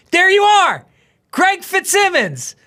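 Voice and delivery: high and loud, then drawn out slowly; excited fan greeting. Retold or imitated. Fan at the airport calling out to Greg, excited and high. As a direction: call out the name like spotting a celebrity. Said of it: "he's all out of breath".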